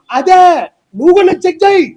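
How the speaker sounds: high, loud snap back; indignant loud comeback; angry retort. Real, not acted. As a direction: fire it back at the accuser. Retort to drunk accusation.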